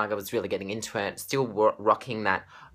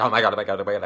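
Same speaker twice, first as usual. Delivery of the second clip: louder breathless oh my God; retold fan gasping; 5 dB louder, much faster than usual. Fan watching Gaga, loud breathless gushing. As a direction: gush it over and over. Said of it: "I was like".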